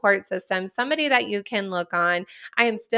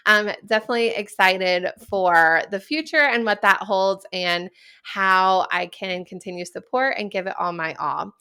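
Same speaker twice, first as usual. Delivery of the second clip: ordinary talk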